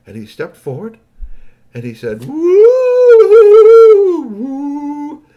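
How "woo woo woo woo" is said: high, loud hooting greeting; alien singing whoops. A narrator's reading. Crown prince steps forward to greet his uncle, high whooping. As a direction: sing out a long alien whoop. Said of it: "a lot of singing going on".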